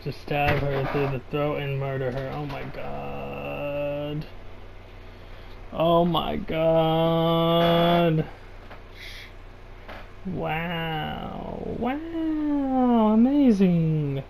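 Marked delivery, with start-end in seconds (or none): 2.3-8.2 very slow, drawn-out groan of disbelief; slow incredulous oh my god; dragged out. Real, not acted. 10.2-14.2 wow swoops high, amazing slow and drawn out; reacting to a murder scene with mock wonder; sarcastic amazement. Real, not acted.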